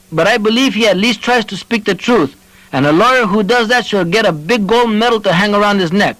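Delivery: put-on high, heartfelt old voice; earnest old man. A narrator's reading. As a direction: plead for unity. Onofre defends his neighbor.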